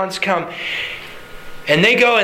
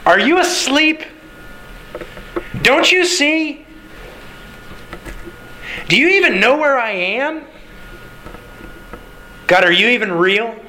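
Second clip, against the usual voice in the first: high, questioning plea to God; desperate prayer; 6 semitones higher than usual. Acted. A believer in pain questioning God, desperate prayer. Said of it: "Might have gone something like this".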